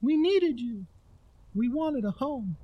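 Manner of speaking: high, strange little creature voice; a small creature calling back to the child; little night creature shouting. A narrator's reading. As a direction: cry out like a tiny goblin. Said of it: "He shouted back".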